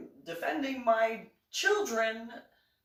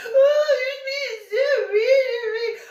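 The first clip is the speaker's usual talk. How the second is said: very high, loud sobbing whine; mock crying wail; 10 dB louder, slower than usual. Anna sobbing at the teller, very high, loud and hammed-up. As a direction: wail like an overdone crybaby.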